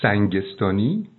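سنگستانی said slowly, syllable by syllable; slow, careful سنگستانی; spelling out a name. Shown as a demonstration. Host spells out the surname for the listener, slowly. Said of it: "آقای رضا سنگستانی".

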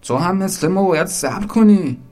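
high, loud complaint; indignant scolding. Acted. Someone waiting to the queue jumper, indignant scolding.

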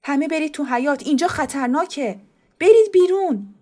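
high, loud urgent order, rising to a yell; shouting. Acted. The uncle orders everyone out into the yard, high and loud shouting. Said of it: "عمو با فریاد گفت".

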